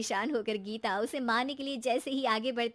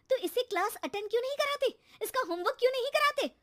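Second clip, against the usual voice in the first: much higher, fast accusing; angry high rant; 11 semitones higher, faster than usual. Acted. Wife accusing husband, high angry questions. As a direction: fire questions at him.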